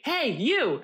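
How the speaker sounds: high, insistent imitated hail; mock calling across the room. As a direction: mimic a man pestering a stranger. Stranger calling her across theater.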